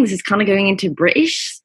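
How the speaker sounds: ordinary talk, nothing marked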